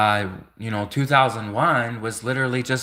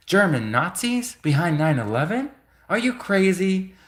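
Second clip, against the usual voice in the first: mock outrage; 7 semitones higher than usual. Mock German denial.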